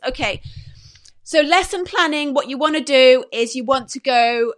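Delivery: ordinary delivery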